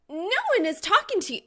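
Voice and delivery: high, loud, fast furious shout; angry snap. Acted. Reaper snapping at Soul, high loud fury. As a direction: lash out at him. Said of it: "Her countenance seems to shrink".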